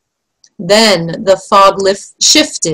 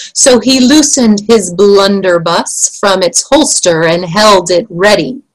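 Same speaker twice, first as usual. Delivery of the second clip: ordinary delivery, nothing marked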